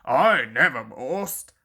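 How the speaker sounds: low, falsely modest engine; mock modest. A narrator's reading. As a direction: pretend humility, still proud. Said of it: "Gordon continued modestly".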